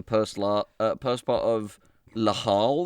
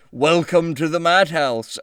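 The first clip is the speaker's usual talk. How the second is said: booming; 6 semitones higher, 6 dB louder, slower than usual. Retold or imitated.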